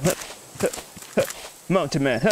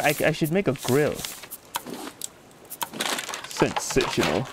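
normal talking voice

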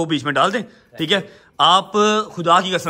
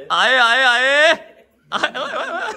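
cheering; 5 semitones higher, much slower than usual. Real, not acted. Cheering as the boy starts eating.

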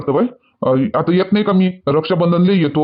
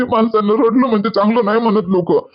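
normal talking voice

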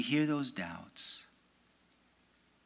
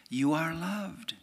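higher, slow and tender; Jesus's words; 6 semitones higher, slower than usual. Retold or imitated. Preacher voicing Jesus to the faithful, slow and tender. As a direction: say it gently, slowly, like a gift. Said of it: "he says all the same".

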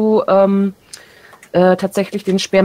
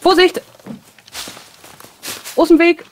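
high warning call; 8 semitones higher than usual. Real, not acted. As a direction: shout a quick warning. Warning while swinging weapon.

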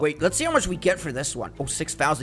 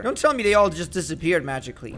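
ordinary talk

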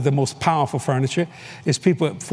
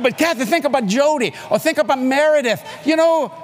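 excited shout; 10 semitones higher than usual. Retold or imitated. Arguing with his wife about the daughters' future, shouting high. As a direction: argue the point, voice rising.

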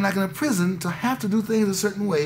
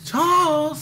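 high call, the name stretched out; calling a child home from far away; eerie high summons; old-time calling voice; 10 semitones higher, 4 dB louder than usual. Retold or imitated. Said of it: "that old time voice".

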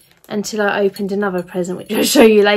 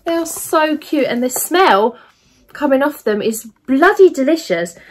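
ordinary talk, nothing marked

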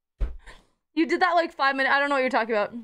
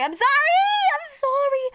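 squeaky mock apology; 10 semitones higher than usual. Playful apology for short stream.

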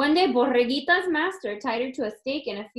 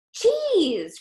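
higher, louder cheese; excited cry; 9 semitones higher, 5 dB louder than usual. Acted. Lamb tempting the coyote, crying out excitedly. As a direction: sell it eagerly. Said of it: "cried Borreguita".

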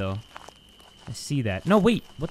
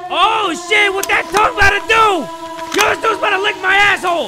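much higher and louder, freaking out; panicked shouting; 13 semitones higher, 10 dB louder than usual. Real, not acted. Player freaking out as the dog lunges, panicked shouting. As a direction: shriek in shocked disbelief.

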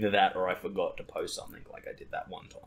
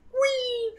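very high, long "wee" squeal like a thrill ride; imitated joyful squeal; 5 dB louder than usual. Friend retells body surfer's GoPro cry, very high squeal. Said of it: "He's just going".

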